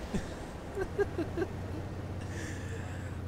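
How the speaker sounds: long, high, soft wheezing laugh; helpless airy giggling; amused laugh. Real, not acted. Commentator cracking up at the tank race, high wheezy laugh.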